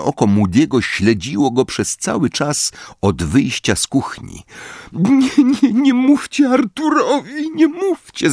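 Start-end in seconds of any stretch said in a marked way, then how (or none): 4.9-8.3 high, keening plea; wailing mother, much higher. Acted. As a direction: beg through sobs. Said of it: "zawodziła pani Wesley".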